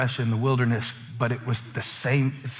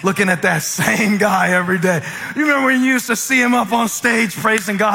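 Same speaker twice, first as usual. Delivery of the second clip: loud, higher, punchy sermon delivery; full-force preaching; 7 semitones higher, 7 dB louder than usual. Real, not acted. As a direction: belt it out to the congregation.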